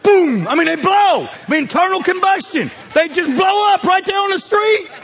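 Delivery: rising from lively to a full shouted climax; building comic shout. Acted. Preacher hams up old folks exploding, building to a shout. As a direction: let the pitch climb until the boom bursts out.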